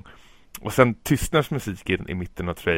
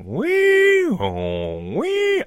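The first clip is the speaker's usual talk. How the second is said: imitated alarm; much slower than usual. Host imitating the trailer's alarm music, high siren wail. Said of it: "ett slags alarm som går i slags repeat".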